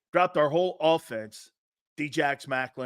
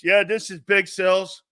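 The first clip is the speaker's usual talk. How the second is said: louder, pompous convention-speech delivery; mock acceptance speech; 4 dB louder than usual. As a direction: address all Americans like a hammed-up nominee. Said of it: "my acceptance speech".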